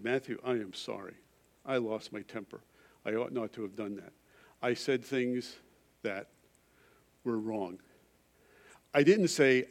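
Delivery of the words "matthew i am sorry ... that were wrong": soft, sincere apology. Retold or imitated. Father apologizes to his young son, soft and sincere. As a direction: say sorry gently and honestly.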